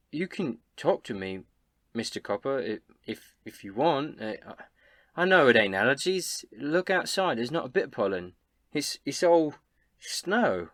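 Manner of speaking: lightly played character voice, halting then insistent; concerned Elsa. Game master plays Elsa comforting.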